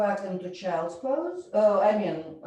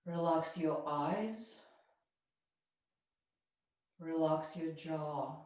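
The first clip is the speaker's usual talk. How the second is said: guided relaxation; 4 semitones lower, 6 dB quieter than usual. Acted. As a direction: ease the class into stillness. Yoga teacher guides relaxation.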